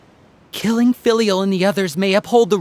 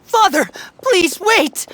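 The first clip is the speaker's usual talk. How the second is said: urgent plea; 8 semitones higher, slower than usual. Acted. Son interrupts the execution order.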